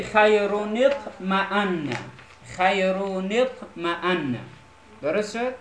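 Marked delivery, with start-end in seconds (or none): 0.0-1.9 "خیرون اطمأن" slowly as khayruni-tma'anna; slow model of "خیرون اطمأن", long final n; linked tanween reading. Shown as a demonstration. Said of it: "در آخری". 2.5-4.4 "خیرون اطمأن" slowly as khayruni-tma'anna; slow model of "خیرون اطمأن", long final n; linked tanween reading. Shown as a demonstration. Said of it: "در آخری".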